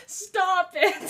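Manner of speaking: protesting through real laughter; helpless giggling protest; laughing. Real, not acted. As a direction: crack up mid-word. Streamer cracking up at chat.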